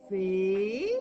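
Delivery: «fé» said alone as one loud, clearly sounded syllable; teacher sounding out «fé». Shown as a demonstration. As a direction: sound out «fé» loudly for a child to repeat. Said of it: "Tu peux m'aider à dire le syllabe".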